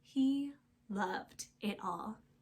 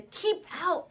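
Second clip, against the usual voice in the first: keep out call; 8 semitones higher, 5 dB louder than usual. A narrator's reading. Warning in story.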